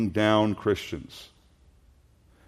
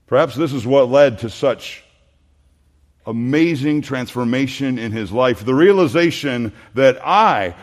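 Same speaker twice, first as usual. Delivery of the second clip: fervent preaching; 5 semitones higher, 8 dB louder than usual. Acted. Preacher voices Saul's realization to the congregation, fervent. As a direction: build the conviction line by line.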